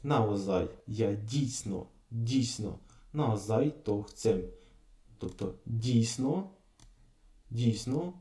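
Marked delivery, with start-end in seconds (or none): none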